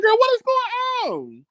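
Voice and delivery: high, slow squeal of disbelief; girl, what is going on, squeaked high and slow; alarmed disbelief. Retold or imitated. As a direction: pitch way up and stretch it out, startled. Nervous as stranger bikes up.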